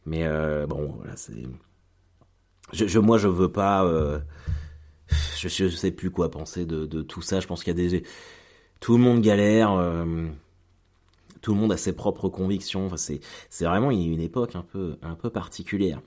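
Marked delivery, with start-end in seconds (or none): none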